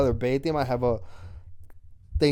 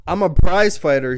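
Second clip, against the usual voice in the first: boastful; 4 semitones higher, 9 dB louder than usual. Retold or imitated. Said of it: "he says".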